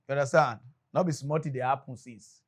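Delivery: usual speaking voice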